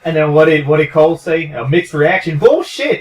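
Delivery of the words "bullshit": high, loud scoff; scoffing shout. Real, not acted. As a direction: bark it out in disbelief. Scoffing at commentator's call.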